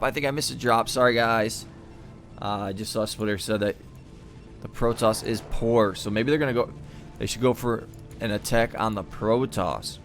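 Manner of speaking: usual speaking voice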